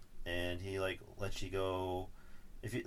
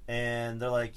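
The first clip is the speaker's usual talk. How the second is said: usual speaking voice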